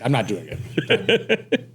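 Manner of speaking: slow words broken by laughter; laughing. Real, not acted. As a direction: laugh through the refusal. Laughs while refusing camera work.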